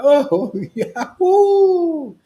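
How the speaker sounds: high, loud ecstatic whoop with laughter; ecstatic devotee. Retold or imitated. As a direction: burst out in laughing rapture at the holy name. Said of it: "hear the name of Krishna and go".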